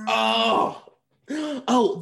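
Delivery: high squeaky oh; ending squeak. Retold or imitated. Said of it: "the closest we get to a traditional squeak".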